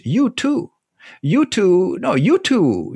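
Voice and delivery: "You too" pitched high, both words drawn out; "You too" sung out high for emphasis; stress on plural you. Shown as a demonstration.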